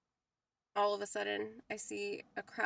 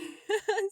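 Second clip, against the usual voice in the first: sudden high-pitched squeal of laughter; 12 semitones higher, 6 dB louder than usual. Real, not acted. Laughing at her green gym bag, a high squealing laugh. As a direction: burst out in a shrill giggle.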